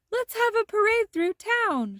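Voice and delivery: rally everyone for a parade; Frosty calling the kids to a parade; cheerful shout. A narrator's reading. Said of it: "shouted Frosty".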